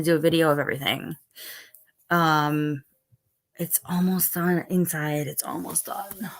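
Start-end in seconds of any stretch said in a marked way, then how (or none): 5.3-6.3 soft whispered repeat; whispering. Real, not acted. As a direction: drop it to a whisper. Repeat whispered right after.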